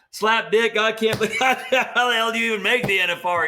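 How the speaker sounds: high, loud imitation of an exasperated dad's yell; dad hollering. Retelling his dad yelling at him during a riding lesson, high loud mock holler. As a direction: belt it out like a fed-up father losing his temper. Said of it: "he's hollering".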